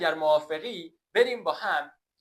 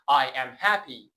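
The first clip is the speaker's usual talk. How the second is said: slow model sentence; 3 semitones lower, 5 dB louder, much slower than usual. Shown as a demonstration. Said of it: "teacher models sentence".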